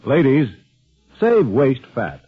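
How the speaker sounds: ordinary talk, nothing marked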